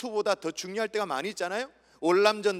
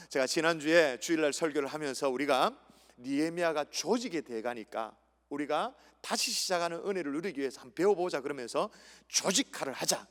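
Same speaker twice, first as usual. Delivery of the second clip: usual speaking voice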